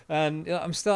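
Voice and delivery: ordinary talk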